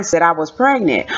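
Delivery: usual speaking voice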